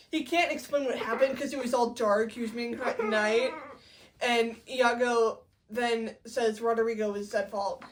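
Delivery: ordinary talk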